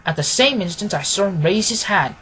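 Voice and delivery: usual speaking voice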